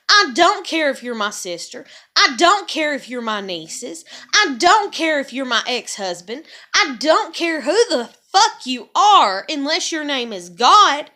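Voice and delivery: angry rant. Real, not acted. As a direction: list them off with rising fury. Escalating rant at family.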